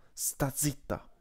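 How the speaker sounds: a big wolf hissing a silencing order; hissed hush. Acted. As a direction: hiss it at the pup to shut her up. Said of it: "sibilò Frandra".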